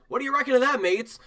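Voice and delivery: put-on high-pitched Australian accent; Aussie dwarf. Little dwarf asking his mates, high Australian accent. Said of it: "I have a little dwarf Australian".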